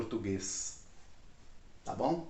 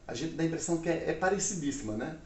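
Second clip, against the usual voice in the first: ordinary delivery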